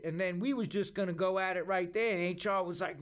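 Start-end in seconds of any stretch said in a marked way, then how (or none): none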